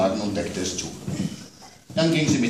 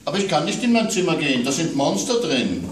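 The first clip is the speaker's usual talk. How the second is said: put-on higher, childlike complaint; child's voice worried about monsters at bedtime; little girl; 8 semitones higher, 4 dB louder than usual. A narrator's reading. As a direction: play the small daughter scared of monsters. Child Amanda voiced in story.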